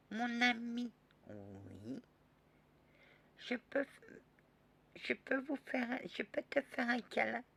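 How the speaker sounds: put-on high child voice, shy request; shy little girl. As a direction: ask for a hug like a small girl. Child asks for hug.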